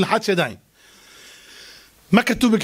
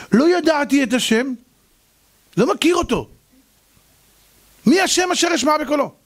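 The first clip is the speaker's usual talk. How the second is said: arrogant; 7 semitones higher than usual. Acted. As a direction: sneer out the defiance. Pharaoh's defiant answer.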